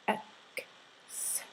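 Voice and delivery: "X" as a voiceless k, then a drawn-out s; separated K and S. Shown as a demonstration. Teacher sounding out the double C, "X" as k plus s. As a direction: split "X" into its two sounds, very softly, k then sss. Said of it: "both the K and S sound".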